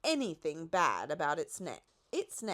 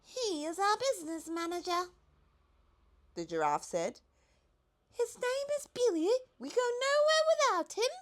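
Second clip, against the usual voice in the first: much higher, light giraffe voice; 14 semitones higher, 3 dB louder than usual. A narrator's reading. The giraffe reminds the Duke of his invitation in a high voice. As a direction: play the lofty giraffe.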